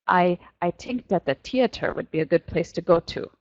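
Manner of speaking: imitated French accent, TH turned into T; French-accented English with hard T for every TH. Teacher imitates francophones missing TH, French accent. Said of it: "they can't make a T H sound".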